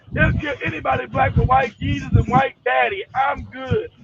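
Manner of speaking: normal talking voice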